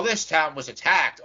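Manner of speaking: ordinary talk, nothing marked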